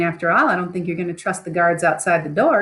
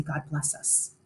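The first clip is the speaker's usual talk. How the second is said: breathless whisper, barely there; the words die in his throat; whispered prayer; 4 semitones lower, 12 dB quieter than usual. Acted. Macbeth cannot say the prayer.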